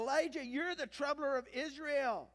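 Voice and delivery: high, loud accusation; acted, ringing denunciation; accusing. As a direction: throw the blame at him loudly. Acting Ahab blaming Elijah.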